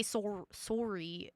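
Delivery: Canadian "sorry" with a rounded "or": SOR-ee. Shown as a demonstration. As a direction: say "sorry" softly with a Canadian "or" vowel. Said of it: "I know we say".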